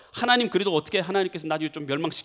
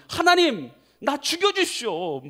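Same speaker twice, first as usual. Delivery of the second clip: irritated Jonah; 7 semitones higher than usual. Acted. Jonah protests to God over the dead plant, irritated. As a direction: act out a petulant, angry plea. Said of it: "신경질가 나는 거예요".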